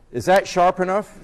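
loud, high, defiant challenge; very angry. Retold or imitated. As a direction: fire the question back, fed up and loud. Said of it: "said in a very angry voice".

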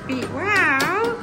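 normal talking voice

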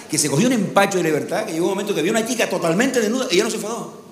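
usual speaking voice

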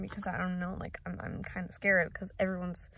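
ordinary talk

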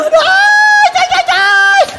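very high, loud wail of "ai"; screaming in pain. Retold or imitated. Client crying as the wax is pulled, screaming in pain. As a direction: shriek a string of hammed-up pain yelps. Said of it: "aquilo corria lágrima e eu gritando".

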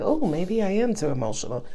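small, unsure inner voice; self-doubting. Acted. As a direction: play someone quietly second-guessing themselves. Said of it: "Some of you might have been like".

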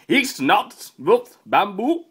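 loud, high fake German; mock German. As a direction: bluff through it in hammed-up German. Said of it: "Now in German".

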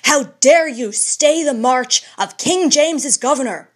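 high, loud, harsh challenge; loud harsh demand. Acted. Governor confronting the old man, loud and harsh. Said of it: "in loud and harsh tones".

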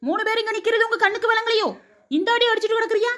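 high-pitched reproachful questioning; scolding onlooker. Acted. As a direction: call out to the brawlers, voice held high. Visitor scolds the brawling women. (உயர்ந்த குரலில் கண்டிக்கும் கேள்வி)

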